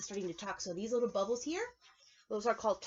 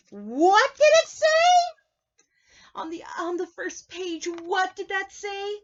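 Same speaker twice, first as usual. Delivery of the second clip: high, loud panicky monster voice; scared Grover; 7 semitones higher, 10 dB louder than usual. Acted. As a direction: gasp and panic like a frightened puppet. Grover frightened by monster warning.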